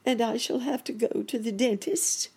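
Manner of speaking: high, anxious rabbit voice; worried rabbit. A narrator's reading. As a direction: ask it dreading the answer. Rabbit asks fearfully.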